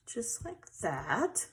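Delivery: slow, soft whisper; hushed as she finishes. Real, not acted. Whispered craft commentary.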